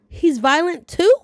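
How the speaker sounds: high, loud disbelieving question, slowed; shocked. Real, not acted. Vlogger reacting to Earl slapping Jenna, loud shocked question. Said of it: "Throw the whole man away".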